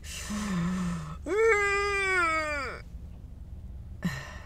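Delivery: long high whine, falling at the end; mock anxious whine. Afraid a backing car will hit theirs, high anxious whine. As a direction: imitate a drawn-out nervous squeal. Acts worry as cars back up.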